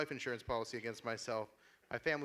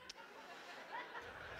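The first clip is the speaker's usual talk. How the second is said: breathy laugh; 15 dB quieter than usual. Real, not acted. Laugh after punchline.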